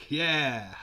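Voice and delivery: loud, high whoop of approval; excited cheer. Pretend. Approving of the girl with the tattoo, excited cheer.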